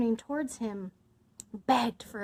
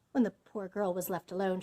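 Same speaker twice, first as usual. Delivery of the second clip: ordinary delivery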